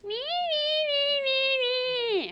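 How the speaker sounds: very high, drawn-out whine; mock whining. Trainer showing the sound that pushes her buttons, mock whining. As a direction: imitate a whining child in a piercing high pitch. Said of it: "like for me, whining".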